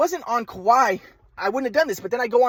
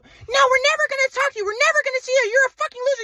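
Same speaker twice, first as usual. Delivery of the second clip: much higher mocking mother; mock mom voice. Son mocking his mother's insults on the phone, high-pitched. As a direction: parody mom's insults in a high voice.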